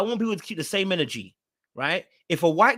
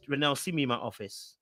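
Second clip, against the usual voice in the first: mock stern boss; 8 semitones lower, 8 dB quieter than usual. Vlogger jokingly summons Renelle to her office, mock stern. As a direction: lower and softer, a teasing stern summons. Scolding a commenter.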